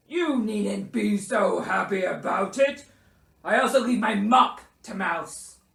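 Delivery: loud, reproachful bear voice; scold, then carry on dictating; miffed Bear. A narrator's reading. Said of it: "he added quickly".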